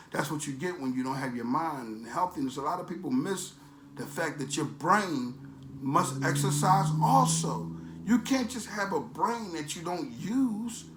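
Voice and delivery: ordinary talk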